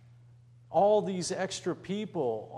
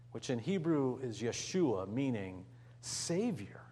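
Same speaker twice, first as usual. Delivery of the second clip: ordinary talk, nothing marked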